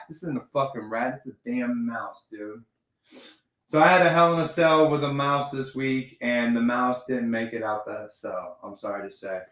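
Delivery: ordinary delivery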